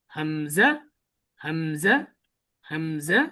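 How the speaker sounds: হামজা said quickly and short with a lift at the end; quick short letter. Shown as a demonstration. Teacher recites the alphabet, hamza kept quick and short. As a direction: snap হামজা out fast, no stretching. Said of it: "হামজা এবং আলিফে তাড়াতাড়ি উচ্চারণ করছি".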